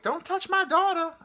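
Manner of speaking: very high, loud mock yell; hammed-up, piercing retort; angry mother impression. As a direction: screech it like an outraged mother. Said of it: "And she's like".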